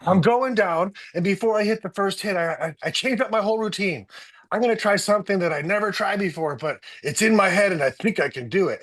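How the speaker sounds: pumped up and sure of himself, high; imitating the athlete. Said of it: "And he goes".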